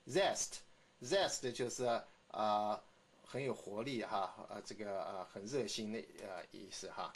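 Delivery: ordinary talk, nothing marked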